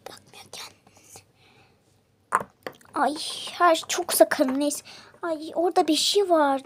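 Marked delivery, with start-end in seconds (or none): 0.1-0.7 whispering. Real, not acted. Hiding from the worker.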